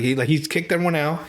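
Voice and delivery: ordinary talk, nothing marked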